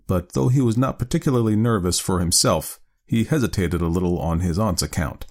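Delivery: usual speaking voice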